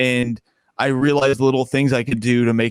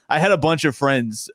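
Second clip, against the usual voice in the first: ordinary delivery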